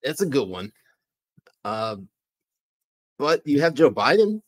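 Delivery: put-on light comic Canadian accent; puzzled host with a Canadian accent. Host asks who hijacked the feed, light Canadian accent. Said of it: "Canadian accent".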